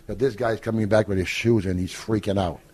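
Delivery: ordinary talk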